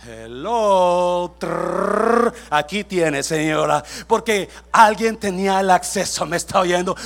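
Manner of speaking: loud, playful boast with trilled flourish; triumphant reveal. Acted. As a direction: announce it loudly as the winning card. Triumphantly acts out using his access. (anuncio triunfal a todo volumen)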